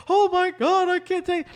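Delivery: high despairing whine; whiny wife. Retold or imitated. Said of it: "She's like".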